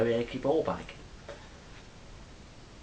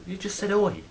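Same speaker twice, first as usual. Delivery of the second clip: louder, higher, puzzled protest; defensive reply; 9 semitones higher, 6 dB louder than usual. Retold or imitated. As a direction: protest your innocence, raised voice. Acted street confrontation, reply.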